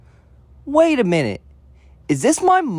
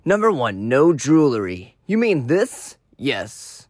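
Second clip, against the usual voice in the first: deep villain voice; 6 semitones lower than usual. Acted. Eggman villain voice.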